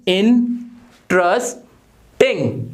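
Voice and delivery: इंटरेस्टिंग said slowly in its three syllables; broken into syllables. Shown as a demonstration. Teacher shows the class the syllables of इंटरेस्टिंग. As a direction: sound out इंटरेस्टिंग one beat at a time. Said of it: "कैसे बोलोगे मुंह से".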